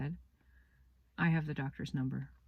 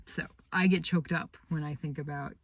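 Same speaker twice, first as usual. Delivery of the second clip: ordinary delivery, nothing marked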